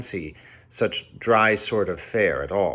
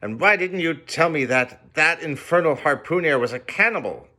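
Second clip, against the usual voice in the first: angry demand; 7 semitones higher than usual. A narrator's reading. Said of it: "shouted I".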